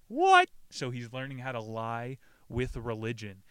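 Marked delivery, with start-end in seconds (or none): 0.1-0.5 very high, loud shriek of disbelief; incredulous yelp. Acted. Reader baffled by the blessing of contentment, incredulous yelp. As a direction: blurt it out, loud and squeaky.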